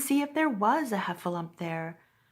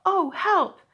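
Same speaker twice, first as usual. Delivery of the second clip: high, loud plea; cry for help; 10 semitones higher, 6 dB louder than usual. A narrator's reading. Pooh calls for help from inside the jar, louder and higher. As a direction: call out for help, stuck. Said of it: "he said inside the jar".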